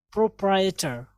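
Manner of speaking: प्रोप्राइटर as "pro-prai-e-ter"; correct pronunciation. Shown as a demonstration. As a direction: keep the middle "e" in प्रोप्राइटर. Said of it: "तो कैसे कहेंगे?". (प्रोप्राइटर में "ए" की आवाज़ भी)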